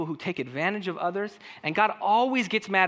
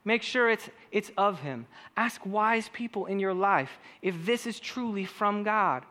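ordinary talk, nothing marked

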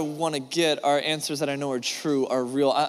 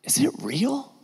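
whisper; 8 semitones higher, slower than usual. Retold or imitated. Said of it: "whispered aside after gossip".

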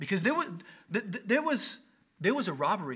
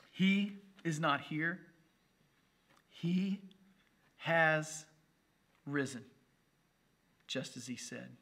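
solemn scripture reading; much slower than usual. Acted. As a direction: read each word as holy news. Reads the angel's scripture words.

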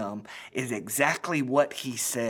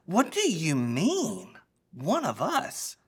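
slow, high, drawn-out incredulous question; can't believe what he just heard; shocked disciple questioning Jesus; stunned disbelief; 8 semitones higher, slower than usual. Acted. Disciple stunned by betrayal news.

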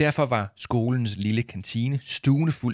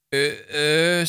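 stammering; 6 semitones higher, 5 dB louder than usual. Acted. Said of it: "stammede Arthur".

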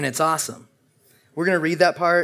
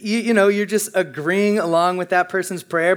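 ordinary talk, nothing marked

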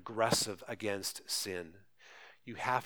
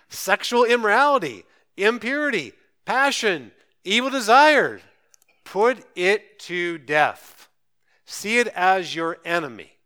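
loud, high punches on each item of the list; forceful preaching; 7 semitones higher, 11 dB louder than usual. Real, not acted. Preacher urging the congregation to fight sin, full force. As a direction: preach it out high and loud, hammering every phrase.

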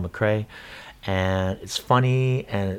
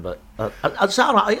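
laughing; 8 semitones higher than usual. Real, not acted. Laughing after admitting rusty accent.

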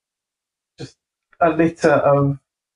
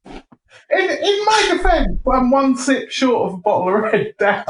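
laughing self-defence; 9 semitones higher than usual. Real, not acted. Defends self while helplessly laughing.

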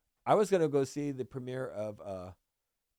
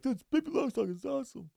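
high-pitched little-brother voice; imitated kid brother; 12 semitones higher than usual. Younger brother loving the dubbed movie, high mock-kid voice. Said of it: "my brother's just like".

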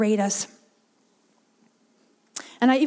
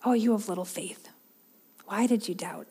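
softer, tender question without blame; not critical; 2 semitones lower, 6 dB quieter than usual. Retold or imitated. Jesus gently asking doubting Peter, soft and patient. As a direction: ask it kindly, with patience. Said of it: "that critical tone that we might read into that".

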